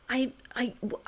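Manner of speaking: ordinary talk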